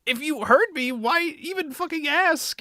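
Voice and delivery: high-pitched mock indignation; exasperated retort. Haruhi snapping back at Tamaki, exasperated. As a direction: act out an outraged comeback.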